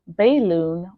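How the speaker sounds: balloon with "bay" as its first syllable; full "ay" vowel replacing the schwa in balloon; mispronounced first vowel. Shown as a demonstration. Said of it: "Notice that we do not say".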